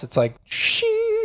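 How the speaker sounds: high, slow drawn-out shing; a squeaky sound effect for a gleam; anime glint sound. Pretend. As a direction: make the sparkle of anime glasses.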